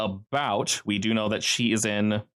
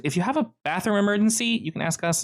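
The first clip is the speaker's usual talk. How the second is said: higher-pitched helpful offer; lightly acted, high-voiced employee; girl employee; 10 semitones higher than usual. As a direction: offer help in a lightly put-on girl's voice. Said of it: "she says".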